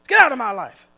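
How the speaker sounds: mock rejection. Acted rejection of returning sin.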